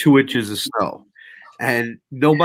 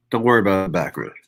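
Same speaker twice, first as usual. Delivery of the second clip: ordinary talk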